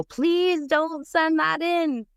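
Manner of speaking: high, drawn-out begging wail; desperate pleading. Retold or imitated. Client begs the officer not to file the paper, high pleading.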